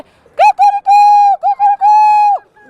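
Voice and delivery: very high, loud crowing calls; rooster call. Acted. The rooster crows at the king's window, high and loud. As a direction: crow shrilly at the window. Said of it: "il fait".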